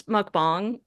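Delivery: "mukbang" drawn out, ending like "bahng"; technically correct pronunciation. Shown as a demonstration. Director answers host's question, slow "mukbang" as "bahng". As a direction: say "mukbang" slowly with an open "ah" in the second syllable. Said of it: "So technically, it's".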